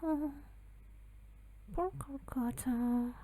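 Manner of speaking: ordinary talk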